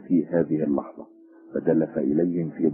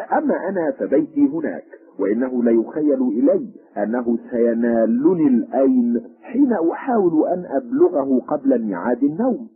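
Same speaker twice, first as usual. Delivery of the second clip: higher, louder character voice; first stranger; 8 semitones higher, 5 dB louder than usual. A narrator's reading. First stranger answering his companion by the fire, higher character voice. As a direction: voice the first stranger with a high lift.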